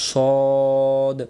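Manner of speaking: সদ with lips rounded, vowel held long; rounded mouth. Shown as a demonstration. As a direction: draw সদ out as "sawwwd". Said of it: "মুখটা গোল হয়". (সদ, গোল মুখে লম্বা টান)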